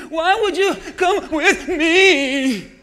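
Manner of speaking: Naomi's lament. Retold or imitated. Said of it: "I'm gonna say the way she did".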